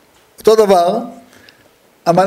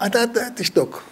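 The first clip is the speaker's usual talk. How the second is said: ordinary talk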